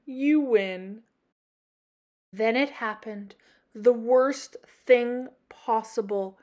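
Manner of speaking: ordinary delivery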